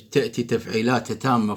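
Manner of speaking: ordinary talk, nothing marked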